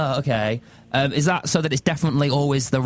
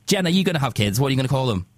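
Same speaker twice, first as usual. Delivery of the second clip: ordinary talk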